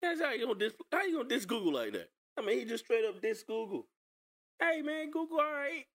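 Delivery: high-pitched playful indignation; mock outrage. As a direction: act shocked, way up high. Mock outrage defending Google.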